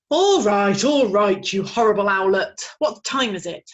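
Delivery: loud, weary owl giving in; grudging father owl. Acted. Father owl giving in to his owlet. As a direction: give in with a groan.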